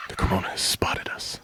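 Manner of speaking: hushed wildlife narrator whisper; mock documentary. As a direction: whisper like a nature show host.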